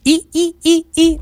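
high squeaky mouse noise; mouse squeak. Pretend. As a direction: squeak like a little rodent. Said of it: "Ah, c'est une souris".

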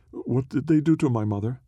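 high, pleading, rising in dread; a frightened child begging to know; distressed girl. Acted. Girl asks about mother, distressed.

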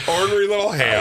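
laughing echo. Real, not acted. Repeating the tease, laughing.

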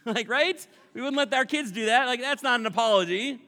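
loud and high, still laughing; laughing indignation. Real, not acted. Laughing with the congregation, loud high indignation. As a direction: share the outrage with a laugh.

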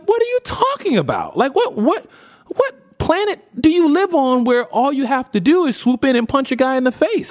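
high, exasperated disbelief; can't believe what he's hearing; incredulous outburst. Retold or imitated. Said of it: "And Batman's like".

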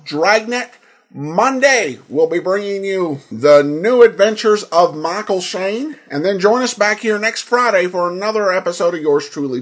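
ordinary talk, nothing marked

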